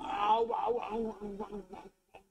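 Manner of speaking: real ow yelp. Real, not acted. Cat bites him.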